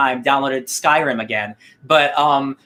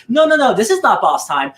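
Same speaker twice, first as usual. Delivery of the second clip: mock protest; 7 semitones higher, 4 dB louder than usual. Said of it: "But Prometheus is like".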